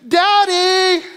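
high, loud call across the house; little girl yelling for her dad; calling out Daddy. Retold or imitated. Daughter calls from back of house.

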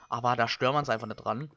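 usual speaking voice

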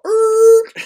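very high, loud mock record scratch. Teacher mimicking the crowd's shock at equality, squealing record scratch. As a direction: imitate a record screeching to a stop. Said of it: "you can hear the record go".

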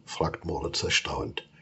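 plain reading voice

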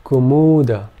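Kumuda with clear vowel lengths; vowel length demo. Shown as a demonstration. Teacher asking students to listen, Kumuda carefully pronounced. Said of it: "Listen carefully to the difference".

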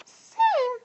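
very high, squeaky worm; Cheryl replying. A narrator's reading. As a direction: pipe the reply in a tiny voice. Cheryl answers her cousin.

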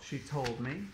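usual speaking voice

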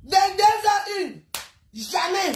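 high, drawn-out indignant quote; stretched-out mocking repeat of a complaint; mocking retort. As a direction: throw the neighbour's words back, slow and high. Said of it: "il me dit quoi?".

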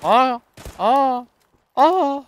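high, loud startled yelps; shocked cries. Real, not acted. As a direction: yelp in disbelief each time. Shocked cries during gunfight.

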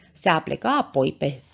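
usual speaking voice

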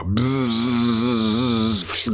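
a loud, high buzz like a fly; loud insect buzz. Retold or imitated. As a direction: imitate a fly buzzing around. Fly on the wall.

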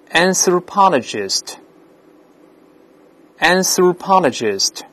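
slow, carefully enunciated vocabulary word; read-after-me model. Shown as a demonstration. English teacher modelling new words for the class, slow model reading. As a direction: model each word slowly for the class to repeat. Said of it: "Now read after me".

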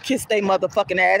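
normal talking voice

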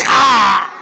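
loud angry yell; angry shout. Acted. Rich man yelling at the beggar's cheek. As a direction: yell in exasperation.

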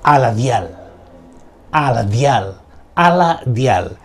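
slow, deliberate magic word; slow incantation. Shown as a demonstration. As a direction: intone it like a spell. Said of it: "Vamos con la primera".

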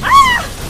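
sudden high scream of fright; real startled shriek. Real, not acted. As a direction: shriek as enemies close in. Startled by nearby enemies.

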